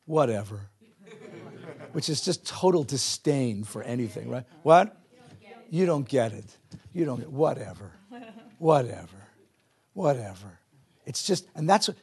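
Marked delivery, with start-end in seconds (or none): 0.1-0.7 put-on teen disdain. Shown as a demonstration. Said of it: "there's a word, by the way, in English that captures this". 4.6-10.6 put-on teen disdain. Shown as a demonstration. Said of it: "there's a word, by the way, in English that captures this".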